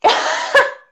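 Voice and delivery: high, airless burst of laughter; breathless laugh. Real, not acted. Caught swearing on air, high breathless laugh. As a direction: laugh it out, barely any voice. Host joked about swearing.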